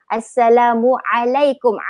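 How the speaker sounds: Assalamualaikum slow, LAM held two counts; Arabic elongation. Shown as a demonstration. Teacher modelling the Arabic greeting for learners, Assalamualaikum slowly elongated. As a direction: draw out the LAM in Assalamualaikum, clear ain. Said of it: "yang penting Assalamu ... lam dua harakat".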